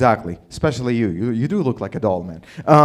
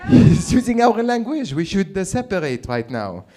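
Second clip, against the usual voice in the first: mock Quebecois; 5 semitones higher, 3 dB louder than usual. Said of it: "these motherfuckers in Quebec were like".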